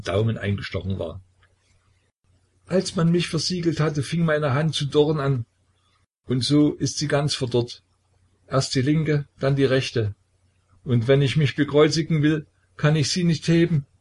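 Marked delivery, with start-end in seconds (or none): 2.7-13.9 high, tearful complaining voice; childlike sobbing. A narrator's reading. Petka showing the brothers his withered hand, high and tearful. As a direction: lament the withered hand like a crying boy. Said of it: "wie ein Kind schluchzend".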